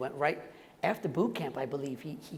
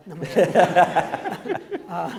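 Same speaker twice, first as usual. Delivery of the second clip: loud laughing "just kidding", slowed; laughing joke; 2 semitones higher, 10 dB louder, slower than usual. Real, not acted. Actor jokes he's finished speaking, laughing loudly. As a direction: laugh through the take-back.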